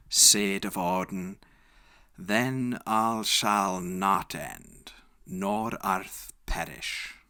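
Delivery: ordinary delivery, nothing marked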